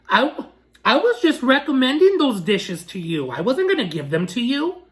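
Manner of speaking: exaggerated, condescending mock voice; sarcastic mimicry. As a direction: mimic him talking down to a customer. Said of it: "so upset with his sarcasm".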